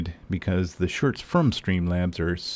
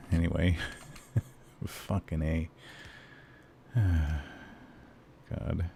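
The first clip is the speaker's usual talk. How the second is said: ordinary delivery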